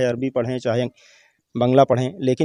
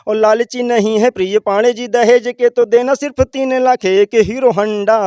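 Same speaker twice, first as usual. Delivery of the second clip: poem recitation; 7 semitones higher, 4 dB louder than usual. Acted. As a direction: belt out the satirical verse like a stage poet. Opening verse recited in dialect.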